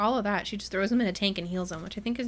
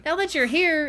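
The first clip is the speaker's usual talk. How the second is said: high, playful and louder; sweetly scheming, pitched up; mock-innocent segue in a raised voice; coy; 8 semitones higher, 6 dB louder than usual. Winter's coy follow-up to her ruse.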